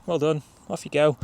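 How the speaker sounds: half-hearted praise. Acted. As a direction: praise the dog without really bothering. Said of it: "half-arsed, not really bothered".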